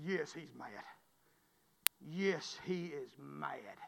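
low, slow and soft; hushed warning. Retold or imitated. Mama warning her son about his daddy, hushed and slow. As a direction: let the dread sink in slowly.

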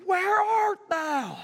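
high, very slow shout; God calling Adam. Acted. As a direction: stretch the call out long. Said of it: "he said unto him".

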